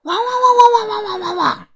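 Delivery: loud, high, nonstop barking; frantic dog barking. A narrator's reading. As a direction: bark wildly in one long breath. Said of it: "深吸一口气，然后狂叫". (一口气高声狂吠)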